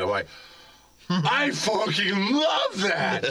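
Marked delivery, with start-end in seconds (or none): 1.1-3.2 slow, laughing shout of delight. Retold or imitated. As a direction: crow it through laughter.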